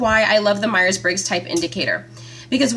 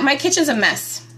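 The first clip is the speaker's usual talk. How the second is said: ordinary talk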